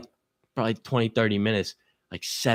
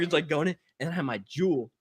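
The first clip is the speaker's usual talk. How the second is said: ordinary talk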